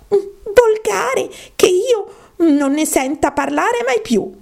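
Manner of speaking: high, trembling-with-outrage little mouse; indignant mouse. Acted. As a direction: rant in a squeaky voice, spitting out each insult.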